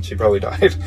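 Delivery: guilty laugh breaking into the words. Real, not acted. Storyteller admits a grim likelihood, laughing guiltily. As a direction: crack up helplessly on the last word. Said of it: "I don't mean to laugh".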